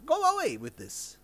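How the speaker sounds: imitating an angry master's outburst; wrathful master. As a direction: send them off with sudden, high-pitched wrath. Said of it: "Rinpoche was very wrathful".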